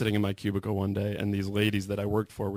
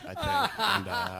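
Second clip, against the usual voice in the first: usual speaking voice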